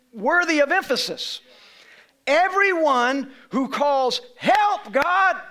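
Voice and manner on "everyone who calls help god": slow, high, loud preached verse, every word leaned on; preaching at full force. Acted. Pastor pressing the verse on his church, preaching loud. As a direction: lift the verse up to the congregation.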